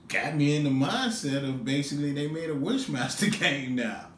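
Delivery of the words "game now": normal talking voice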